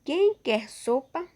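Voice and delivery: "Quem quer sopa?" slowly, high and loud, rising as a question; question tune. Shown as a demonstration. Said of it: "Uma pergunta".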